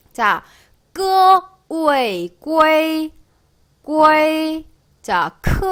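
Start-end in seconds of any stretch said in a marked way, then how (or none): 1.7-4.7 우에이 then 꾸에이 held on a high tone; drawn-out, level 꾸에이 built from 우에이; syllable drill. Shown as a demonstration. Teacher blending initial and final, syllable drill.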